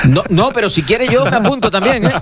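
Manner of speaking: high-pitched laughing reply. Real, not acted. Co-host to guest who mistook him for rector, laughing. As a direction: joke through real laughter, voice lifted high. Joking after being mistaken for rector.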